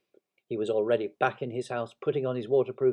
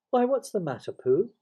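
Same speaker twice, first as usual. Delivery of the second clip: high, louder questioning voice; concerned boy; 10 semitones higher, 6 dB louder than usual. Acted. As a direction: ask with puzzled concern. Christopher Robin asks with concern.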